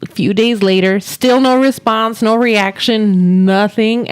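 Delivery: usual speaking voice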